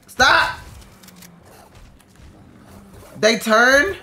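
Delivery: high, loud cry to make them stop; panicked shout. Real, not acted. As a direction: yell in alarm. Fighting off attackers.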